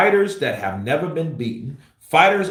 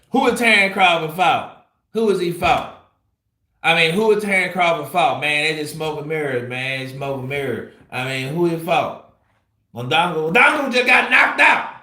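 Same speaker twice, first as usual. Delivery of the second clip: imitating a fighter; 5 semitones higher, 3 dB louder than usual. Mocking Crawford's trash talk.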